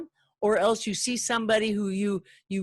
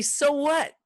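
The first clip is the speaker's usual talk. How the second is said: dismissive retort; 8 semitones higher than usual. Pretend. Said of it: "I wanna say".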